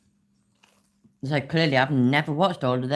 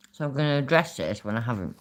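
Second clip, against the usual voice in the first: usual speaking voice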